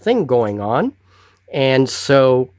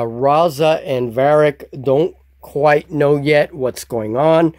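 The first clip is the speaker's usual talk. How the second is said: usual speaking voice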